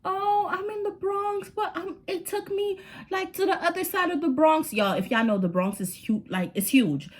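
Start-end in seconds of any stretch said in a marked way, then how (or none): 0.0-4.7 innocent lie. Retold or imitated. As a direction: fake casual high voice covering a lie. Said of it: "I'm like".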